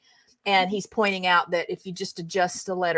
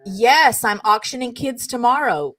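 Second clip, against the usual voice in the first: ordinary delivery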